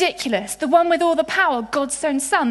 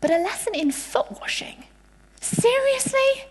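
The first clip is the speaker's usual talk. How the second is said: mock disbelief; 10 semitones higher than usual. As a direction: act incredulous, stretching the last word up high. Voicing disciples' disbelief at foot washing.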